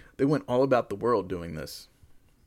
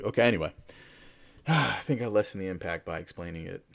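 usual speaking voice

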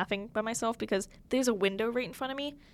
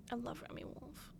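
whispering; 15 dB quieter than usual. Real, not acted. Near-silent whispered declaration.

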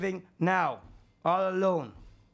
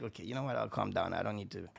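much softer, sinking into a low murmur; calming down; 9 semitones lower, 8 dB quieter, faster than usual. Retold or imitated. After yelling at his sister, he quietly calms himself down. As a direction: let the anger drain out, voice dropping away.

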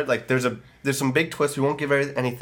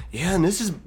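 put-on failed [name] accent; 8 semitones higher than usual. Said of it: "I forgot my [name] accent".

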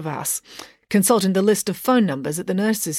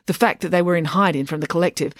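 ordinary delivery, nothing marked

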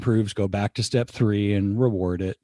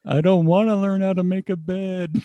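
whiny child imitation; 8 semitones higher than usual. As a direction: mimic a child refusing chores.

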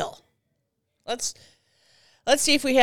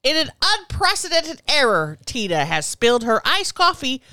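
hammed-up broadcast announcement, louder; mock announcer; 3 semitones higher, 6 dB louder than usual. Host announcing the coffee spill to listeners, mock announcer voice. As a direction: play it like a ringside announcer.

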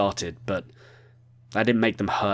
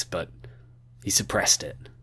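ordinary delivery

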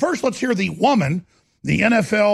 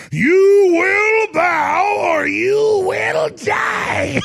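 shouting; 4 dB louder, much slower than usual. Retold or imitated. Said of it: "The media said".

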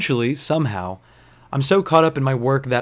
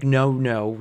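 normal talking voice

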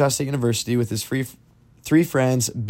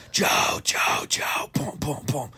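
urgent whispered calling with pounding noises; whispered mock yell; 3 semitones higher, 5 dB quieter, slower than usual. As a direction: imitate shouting through a door, all in whisper. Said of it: "they're pounding on the door".

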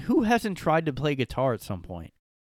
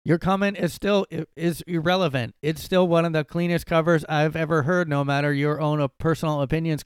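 raised, higher voice, defending hard; loud mock indignation; 5 semitones higher, 6 dB louder than usual. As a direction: read it like an offended fan. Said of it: "And then Jay responded".

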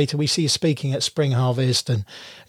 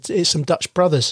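ordinary talk, nothing marked

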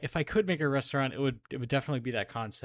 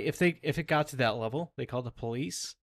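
ordinary delivery

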